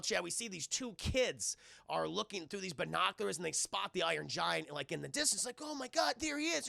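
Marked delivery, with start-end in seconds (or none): 5.6-6.7 put-on high kid's voice; excited kid. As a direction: imitate a child spotting something. Kids spotting giant.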